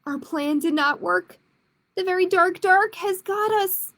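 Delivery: put-on reading to children, clear and lively; picture book read aloud; story narration. A narrator's reading. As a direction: tell it like storytime for kids.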